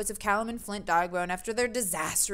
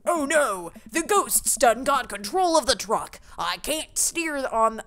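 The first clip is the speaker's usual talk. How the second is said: put-on slow, drawn-out Southern accent; 2 semitones higher, 3 dB louder, slower than usual. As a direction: lay on a hammy Southern drawl. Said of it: "most stereotypical Southern accent".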